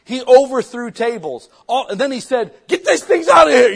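loud, high, fervent sermon; fiery preaching. Acted. Pastor fires up the congregation about Jesus in the temple, loud and fervent. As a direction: preach it with full fire.

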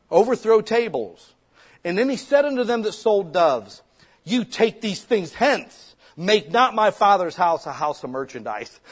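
loud, high, fired-up scripture reading; preaching at full force. Acted. Preacher reads the temple scene to his congregation, loud and fiery. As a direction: thunder the verse out to the church.

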